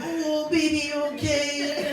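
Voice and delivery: high, slow sing-song fussing; cooing parent. Acted. Parent running up to a fallen toddler, cooing. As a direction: coo in mock concern, drawing out the words.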